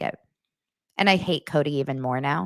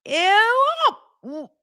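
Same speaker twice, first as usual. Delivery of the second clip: very high, drawn-out "ew"; disgusted squeal; 4 dB louder than usual. Real, not acted. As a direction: squeal in exaggerated revulsion. Disgust at retold dinner scene.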